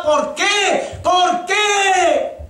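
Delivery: high desperate shouting; anguished why. Retold or imitated. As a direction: scream the question to heaven. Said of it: "gritaba y gritaba".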